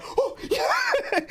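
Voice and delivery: high squealing yell of joy; hyped. Retold or imitated. Streamer replaying his hyped reply to Mel, high squeal. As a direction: burst out high with excitement. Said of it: "I was just like".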